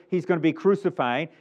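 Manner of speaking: ordinary delivery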